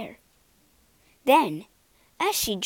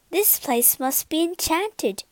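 wondering; 6 semitones higher than usual. Acted. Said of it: "Beauty realized".

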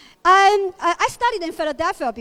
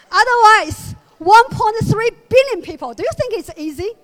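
louder, higher, laughing as she speaks; laughing through it; 6 semitones higher, 10 dB louder than usual. Real, not acted. Comedian laughs through her sex joke punchline. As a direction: crack up at your own punchline.

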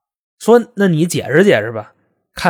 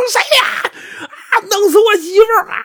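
hammed-up sobbing; much faster than usual. Gary hears his wife died and wails, hammed-up sobbing. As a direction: wail it out like a grieving husband. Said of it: "这个人就哭了".

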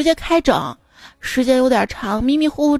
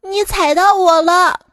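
high-pitched indignant yelp; high little voice calling out; crying out; 7 semitones higher, 3 dB louder than usual. Acted. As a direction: cry out in a small high protest. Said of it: "蚂蚁叫道".

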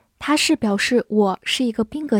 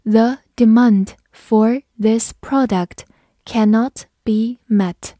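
"The demand for this product cannot be met" slowly, word by word; slow reading; slower than usual. Shown as a demonstration. Teacher announces a slow reading, very slow. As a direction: read it slowly for the class. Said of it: "好，我们慢读一遍".

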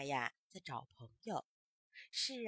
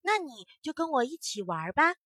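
a louder, brighter glad invitation; happy; 4 semitones higher, 9 dB louder than usual. Acted. Firefly happily invites the grasshopper, louder and bright. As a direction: invite your new friend gladly. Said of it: "萤火虫高兴地说".

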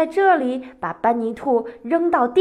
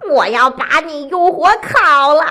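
furious fox yelling, louder, timbre pushed; loud angry shout; 6 dB louder than usual. A narrator's reading. Furious fox threatens the eagle, loud angry shout. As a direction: shout the threat in a storybook fox's rage. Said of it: "狐狸大声地对鹰喊道".

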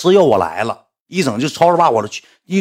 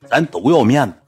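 usual speaking voice

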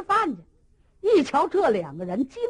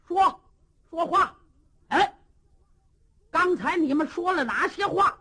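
angry interrogation; 7 semitones higher than usual. Acted. Cheng Yaojin demanding a confession from the two prisoners, shouting. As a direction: roar at the prisoners to talk.

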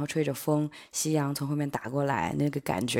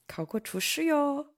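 surprised; 10 semitones higher, slower than usual. Real, not acted. Teasing surprise at cook exam.

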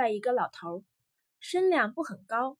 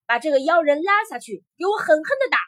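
loud, raised-pitch furious command; angry order; 5 semitones higher, 8 dB louder, faster than usual. A narrator's reading. Emperor ordering warriors to beat the prisoner, angry. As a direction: order the punishment with force. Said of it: "皇帝发了火".

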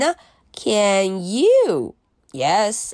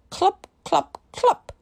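1 hooves clopping; 9 semitones higher than usual. Pretend. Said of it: "He can sound like horse feet".

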